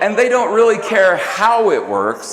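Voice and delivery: loud, laughing emphasis. Real, not acted. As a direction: punch the point home. Emphatic point to laughing audience.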